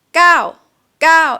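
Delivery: gao shouted high and loud, dropping; yelled falling tone. Shown as a demonstration. Teacher recommending the yell-like falling tone, loud. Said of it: "easier to just say it like this".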